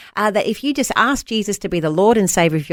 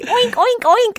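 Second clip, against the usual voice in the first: much higher squeaky oink oink oink; child's oink; slower than usual. Retold or imitated. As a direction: squeal it like a toddler. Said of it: "in our house it's still".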